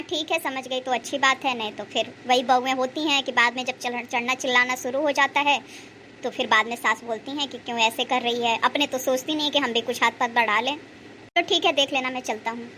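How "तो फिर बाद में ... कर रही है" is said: normal talking voice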